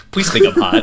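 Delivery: high, pleading mock voice; mock needy plea. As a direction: beg like a vain rapper. Mocking rapper's plea.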